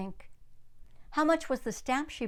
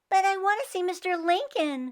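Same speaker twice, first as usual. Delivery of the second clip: eager protest; 8 semitones higher, 4 dB louder than usual. Acted. Grace protests being held back.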